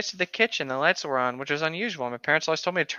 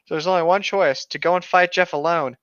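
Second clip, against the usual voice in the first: usual speaking voice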